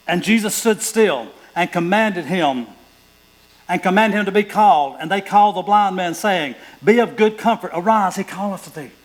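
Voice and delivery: loud, high proclaiming; preaching scripture. Acted. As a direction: announce the call like a herald.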